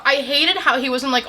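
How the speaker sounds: normal talking voice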